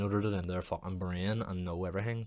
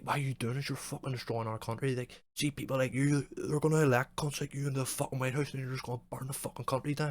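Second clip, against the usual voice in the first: higher, louder, hammed-up fury; mock ranting troll; 7 semitones higher, 4 dB louder than usual. Imitating a commenter raging at the rescuer, mock fury. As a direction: rant like the country is ending. Mocking angry reply continues.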